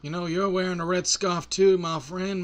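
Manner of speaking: drawn-out, put-on Western voice; cowboy lawman. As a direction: play a cowboy with an easy drawl. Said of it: "I'll give him that voice instead".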